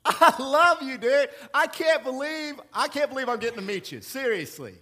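Satan sweet-talking Jesus, giddy and loud; flattering Satan. Acted. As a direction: gush over him like a smooth-talking devil. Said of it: "Satan always says".

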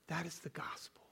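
whispered, That is the gospel. Real, not acted. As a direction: breathe it out as a whisper. Whispered line.

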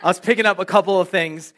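repeats the line through a real laugh; can't keep a straight face saying it; giggling as he starts over. Real, not acted. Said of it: "That was funny".

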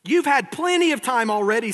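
loud, high-pitched indignant protest; angry. Acted. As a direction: snap it out as an acted angry complaint. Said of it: "said the Tin Woodman angrily".

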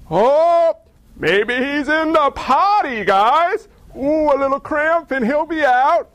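mocking. Elijah lounging on a rock, teasing the frantic prophets. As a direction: needle them like a bored heckler. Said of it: "He's mocking them".

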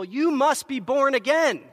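loud, high preaching call; preacher's cry. Retold or imitated. Whitfield crying out to the crowd, loud preaching. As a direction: proclaim it to the crowd.

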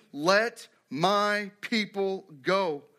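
"my" set apart and stretched in a slow command; stress on MY. Shown as a demonstration. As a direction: spell it out slowly, leaning on "my". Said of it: "my" is extremely important there".

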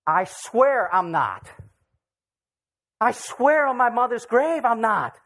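loud, forceful sworn oath, drawn out; emphatic denial. Acted. Preacher acting Peter's denial to the congregation, loud and emphatic.

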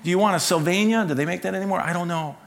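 put-on high, fast, anxious begging from a nervous teenage son; pleading son. Son phoning Dad from Best Buy, begging him to choose the player, high and pleading. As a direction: beg Dad to decide for you, voice climbing.

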